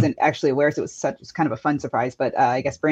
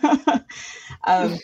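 laughing; 4 semitones higher than usual. Real, not acted. Laughs while catching breath.

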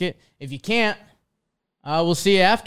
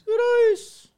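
squeaky high greeting; tiny cartoon voice; 5 dB louder than usual. Silly voice again.

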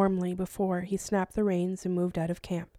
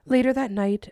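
ordinary delivery, nothing marked

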